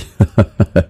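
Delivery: a booming burst of laughter; hearty guffaw, falling off; loud guffaw. A narrator's reading. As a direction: let out a big belly laugh. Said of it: "Vikrant फिर से ठहाका लगाते हुए हंस पड़ा".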